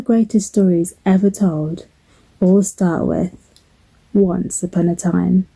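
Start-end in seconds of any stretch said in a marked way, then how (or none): none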